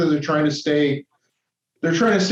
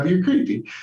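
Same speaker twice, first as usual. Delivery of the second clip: ordinary delivery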